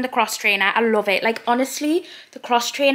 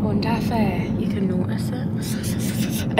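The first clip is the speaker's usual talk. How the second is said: whispering; slower than usual. Real, not acted. Aside to camera about lisp.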